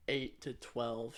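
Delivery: ordinary talk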